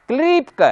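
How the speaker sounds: क्लीपक with a loud, high, stretched ली; wrong pronunciation. Retold or imitated. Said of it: "असक्ति के कारण".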